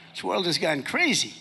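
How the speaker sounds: normal talking voice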